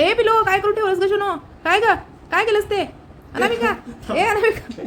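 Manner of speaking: high-pitched doting telling-off; high, sing-out pet-owner reproach; scolding a pet. Real, not acted. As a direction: scold your naughty little pet in a high voice. Mock-scolding the pet over chewed file.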